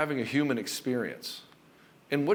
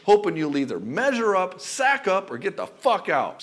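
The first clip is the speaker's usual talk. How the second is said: building rant; 6 semitones higher, 5 dB louder than usual. Acted. Building rant about her anger.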